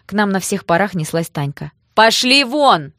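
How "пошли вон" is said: loud, high, drawn-out order; furious yell. Acted. Sister chases thugs off at full run, yelling. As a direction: bellow them away.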